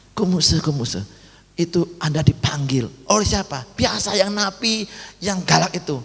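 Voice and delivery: a hint of a worried prison warden; warden calling. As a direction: call him urgently like the prison guard.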